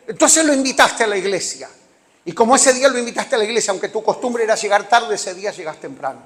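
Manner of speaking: loud, pressing exhortation; emphatic preaching. Acted. Preacher telling believers they will arrive early, loud and urgent. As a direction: drive the point home. Preacher drives home point.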